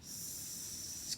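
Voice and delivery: unvoiced s sound; hissing s. Shown as a demonstration. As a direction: hiss out a long s. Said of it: "and then".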